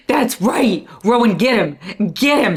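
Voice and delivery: shouting encouragement; loud cheering. Real, not acted. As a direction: cheer him on loudly. Cheering a character.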